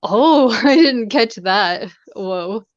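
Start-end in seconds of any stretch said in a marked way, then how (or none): none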